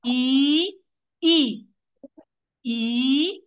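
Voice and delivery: put-on "ई" called out clearly, one by one, for the children; teacher drilling the vowel "ई" aloud; vowel demo. Shown as a demonstration. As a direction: sound out "ई" repeatedly like a teacher. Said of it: "म्हणजे आपण काय करतो?".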